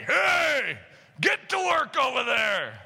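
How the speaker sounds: gruff, drawn-out holler; rough boss shouting at workers; gruff burly foreman. Acted. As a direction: bark orders as a burly factory boss. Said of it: "that's what he sounds like".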